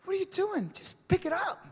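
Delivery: very high-pitched, incredulous mock dad; exasperated dad. Dad urging his son for the last time, exasperated. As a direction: voice leaps up in acted disbelief.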